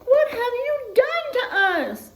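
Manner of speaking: high, loud, slow demand; angry demand. Acted. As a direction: confront them furiously. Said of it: "demand the warthogs".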